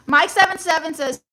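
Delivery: ordinary talk, nothing marked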